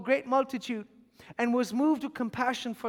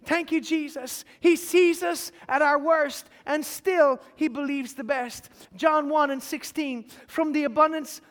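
loud, rising sermon delivery; fervent preaching; 3 semitones higher, 5 dB louder than usual. Acted. Pastor urging the watching congregation, full-force preaching.

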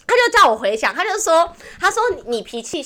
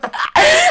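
loud high burst of laughter; shrieking laugh; 8 dB louder than usual. Real, not acted. Cracking up at the fortune teller's advice, loud shrieking laugh. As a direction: let out a shrieking cackle.

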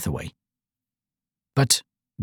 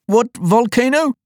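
high, slow, alarmed question in a king's voice; anxious; 12 semitones higher, 4 dB louder than usual. A narrator's reading. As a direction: draw it out high, as if worried. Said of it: "looking up anxiously into the fire".

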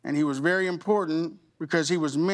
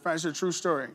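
ordinary talk, nothing marked

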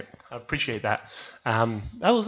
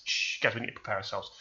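whispered, much softer warning; hushed warning; 4 semitones lower, 13 dB quieter, faster than usual. Acted. Warning friends before Hannibal Lecter arrives, hushed. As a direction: hush the room before danger.

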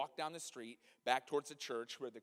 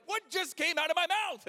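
high, loud shock at himself; shocked disbelief; 13 semitones higher, 10 dB louder than usual. Retold or imitated. The preacher shocked at his own invitation to pray, loud and high. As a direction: gasp it out in disbelief.

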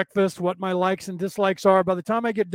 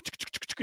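tutting; 15 dB quieter, faster than usual. Retold or imitated. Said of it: "And she was like".

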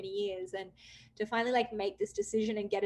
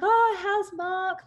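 chirpy friend; 10 semitones higher, 9 dB louder, much slower than usual. Retold or imitated. Friend's chirpy question retold.

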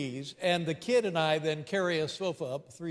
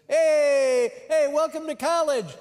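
booming high welcome, much louder; loud cheery greeting; 10 semitones higher, 8 dB louder, slower than usual. Retold or imitated. Student helper greets a new family, loud and cheery. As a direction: shout a hyped welcome at arriving cars.

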